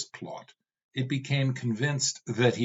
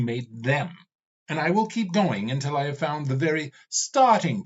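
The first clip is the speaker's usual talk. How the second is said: mad, insane; 3 semitones higher than usual. Acted. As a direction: vow it like a madman. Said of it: "Ravana sounded mad, insane".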